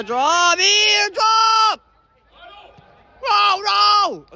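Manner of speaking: very high loud call into dismay; excited shout. Real, not acted. Commentator reacting to a near goal, high excited shout. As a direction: shout then groan the miss. Chance missed live.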